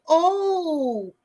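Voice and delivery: long, high drawn-out "oh"; mock surprise. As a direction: stretch it out, imitating his surprise. Retold man's condescending surprised reaction.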